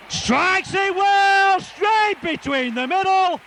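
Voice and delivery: high, slow, drawn-out shout; excited shout. Real, not acted. Commentator calling the goal kick, drawn-out shout. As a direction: cheer the kick between the posts. Conversion kick goes over.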